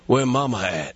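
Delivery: lazy tough-guy cop impression; Steven Seagal impression. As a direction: take on someone else's dialect, dead serious. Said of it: "takes on the, uh, the dialect".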